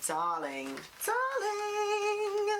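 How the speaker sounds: sung high and loud "darling, darling"; posh diva. Pretend. Unboxing a palette, hammed-up diva singing darling. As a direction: swoon like a grand diva. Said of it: "I'm about to be like".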